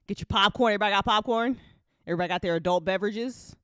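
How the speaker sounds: rally everyone for the show; hyping the crowd. Real, not acted. Host hyping listeners before the episode, loud.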